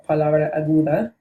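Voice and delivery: palabra aguda said in Spanish, pa-LA-bra a-GOO-da; Spanish pronunciation. Shown as a demonstration. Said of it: "acute accent style word in Spanish".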